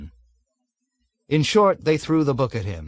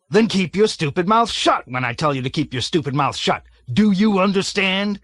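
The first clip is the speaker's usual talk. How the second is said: loud, higher barked rebuke; angry shout; 7 semitones higher, 4 dB louder than usual. A narrator's reading. Colonel shouts down Major Metcalf angrily. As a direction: tear into him.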